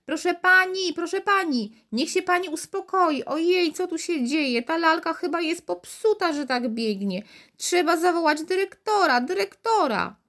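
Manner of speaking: high, fussy saleswoman's cry; flustered calling. A narrator's reading. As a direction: call out in a fluster. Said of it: "Wołała".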